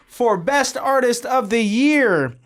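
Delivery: slow, drawn-out awards announcement; award announcer. Pretend. Host at the Grammys announcing the best artist, slow and drawn out. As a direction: stretch the winner's name like a presenter.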